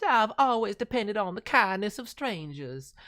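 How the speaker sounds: Southern accent, sweetly put on. Reviewer tossing off a quick Southern accent. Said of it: "I always love me a good Southern accent".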